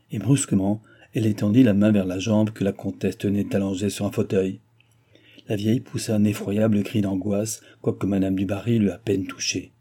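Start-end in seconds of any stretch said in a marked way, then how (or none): none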